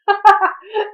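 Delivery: loud high burst of laughter; laughing at own mistake; real laugh. Real, not acted. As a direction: crack up at the misread word. Reader laughs at own slip.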